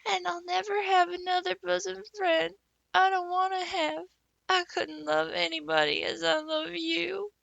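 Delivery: put-on high earnest girlish vow; a girl swearing devotion through tears; weepy best friend promising forever; tearful girl. A narrator's reading. Diana vows through tears.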